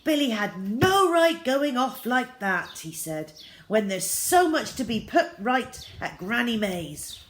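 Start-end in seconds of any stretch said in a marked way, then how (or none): 0.0-2.8 angry father shouts, higher and louder; furious father, louder and higher; shouting. A narrator's reading. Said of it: "and shouted". 3.7-7.2 shouting. A narrator's reading. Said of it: "and shouted".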